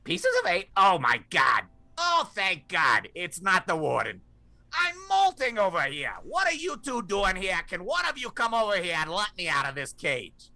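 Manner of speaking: shrill squawking parrot voice; high screechy bird, frantic. Acted. GM voices caged parrot Iago.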